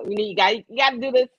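usual speaking voice

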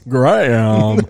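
laughing through the word; barely holding in a laugh. Real, not acted. Punning gram of drugs.

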